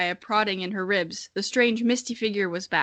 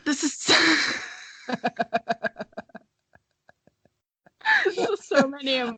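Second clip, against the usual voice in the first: laughing; 5 semitones higher, faster than usual. Real, not acted. Overwhelmed, laughing-crying.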